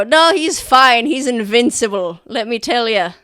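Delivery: loud, boisterous soldier voice; soldiers joking. Acted. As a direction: laugh it off, proud of him. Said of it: "you hear them go".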